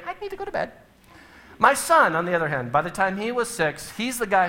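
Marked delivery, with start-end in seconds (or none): 0.1-0.7 high, mock-prim little girl voice; prissy child mimic. Dad mocking his daughter's bedtime request, high and prim. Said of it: "and you're saying".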